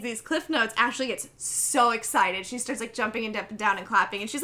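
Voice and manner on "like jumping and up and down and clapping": normal talking voice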